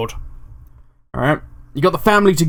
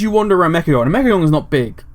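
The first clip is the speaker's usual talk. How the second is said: ordinary delivery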